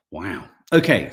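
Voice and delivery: normal talking voice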